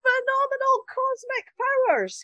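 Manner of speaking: lightly voiced booming Genie; a hint of the big showman Genie. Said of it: "he sprang out of this lamp and he said".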